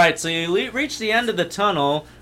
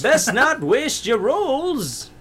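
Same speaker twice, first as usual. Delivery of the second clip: put-on old guard voice; 7 semitones higher than usual.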